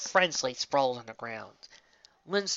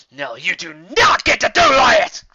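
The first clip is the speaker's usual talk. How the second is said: furious shout; 6 semitones higher, 16 dB louder, slower than usual. Acted. Refusing the vision.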